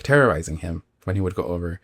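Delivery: ordinary talk, nothing marked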